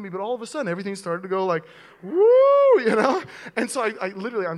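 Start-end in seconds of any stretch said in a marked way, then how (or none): 2.0-2.8 high, loud woozy woo; dizzy woo. Retold or imitated. Pastor imitating the dizzy spell, a high woozy woo. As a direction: let it swoop up like the room spinning. Imitating dizziness before fainting.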